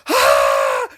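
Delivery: loud deep glitch yelp; a broken machine squawk; glitchy squawk. Acted.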